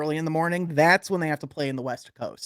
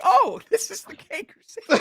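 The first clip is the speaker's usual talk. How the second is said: laughing. Retold or imitated.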